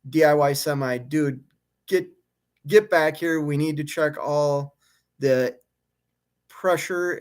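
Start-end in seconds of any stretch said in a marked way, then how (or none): none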